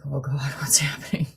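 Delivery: breathy anxious whisper to herself; nervous whisper. Retold or imitated. Whispering to herself as the angry teammate approaches, anxious. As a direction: whisper it, dreading what's coming.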